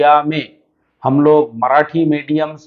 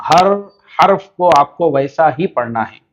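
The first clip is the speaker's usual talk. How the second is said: ordinary talk, nothing marked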